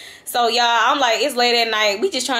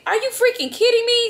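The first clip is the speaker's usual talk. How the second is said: high-pitched retold disbelief; incredulous; 12 semitones higher than usual. Passenger reacting to the driver's new higher price, incredulous. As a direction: let the voice leap up in exasperation.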